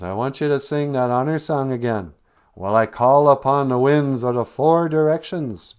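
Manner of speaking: loud, solemn call from above; commanding spirit. Acted. Gitche Manado calls down to the animals, loud and solemn. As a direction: proclaim it like a great spirit.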